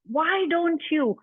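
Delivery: usual speaking voice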